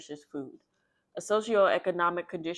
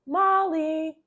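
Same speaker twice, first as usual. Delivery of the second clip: higher, louder call across the street; 11 semitones higher, 8 dB louder than usual. Acted. Caitlin spots Molly on the walk home, calling out her name. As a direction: hail a friend from afar.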